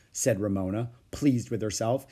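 ordinary delivery, nothing marked

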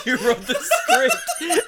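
high, drawn-out words through real laughter; cracking up. Real, not acted. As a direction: push it out while laughing hard. Laughing at the absurd script.

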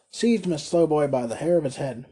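plain reading voice

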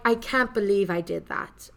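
mock British accent, second try; a lightly put-on British accent; retry the quote in a jokey British accent. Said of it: "what's my British accent".

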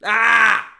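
replaying his furious TV scream, ending in a wordless howl. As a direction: yell at the referee through the TV. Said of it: "screaming at this T V".

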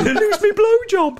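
high laughing mock whine. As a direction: squeal it out laughing.

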